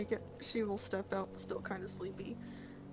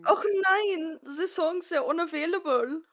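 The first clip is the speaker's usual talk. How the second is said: high, louder comic German wail; mock German accent; 7 semitones higher, 4 dB louder than usual. Player echoing the missing songs lament, mock German accent.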